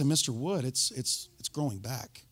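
fading to a near hush; quietly fading delivery of the diagnosis; quiet bad news. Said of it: "they say".

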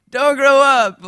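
high, loud, drawn-out begging; slow, adoring mock wail; doting plea. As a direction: plead playfully with a baby. Father begs baby to stay little.

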